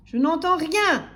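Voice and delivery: slow, high, loud yell; vociferating greengrocer. A narrator's reading. Greengrocer dismissing timid customer, yelling. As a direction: yell it with drawn-out force. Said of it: "vociféra le marchand de légumes".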